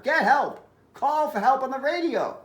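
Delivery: put-on high-pitched, urgent boy; urgent plea. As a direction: press the policeman to get help. Boy urges policeman to get help.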